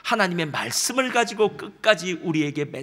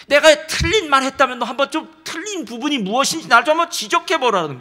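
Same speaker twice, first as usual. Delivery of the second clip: louder, pressing God confronting Jonah; forceful reasoning; 5 semitones higher, 7 dB louder than usual. Retold or imitated. God arguing with Jonah, loud and pressing. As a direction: corner him with the facts, loud.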